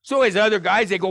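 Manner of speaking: normal talking voice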